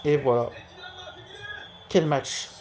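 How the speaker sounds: lively sports commentator calling a match; play-by-play commentary. Acted. Live football game commentary. (commentaire sportif en direct)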